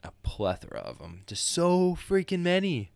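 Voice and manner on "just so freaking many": high, slow emphatic squeal; exaggerated. Real, not acted. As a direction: stretch it out high in disbelief. Emphatic disbelief.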